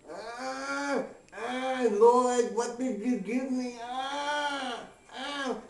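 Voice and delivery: high, slow, pained groaning; moaning buddy. Retold or imitated. Buddy on the hospital bed, groaning high and slow. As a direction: moan it out like a drugged man in agony.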